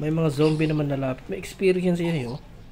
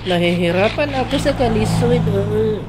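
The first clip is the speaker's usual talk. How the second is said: loud, raised-pitch complaint; exasperated outburst; 3 semitones higher, 6 dB louder than usual. Real, not acted. Player struggling with a hard fight, exasperated. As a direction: blurt it out in struggling protest.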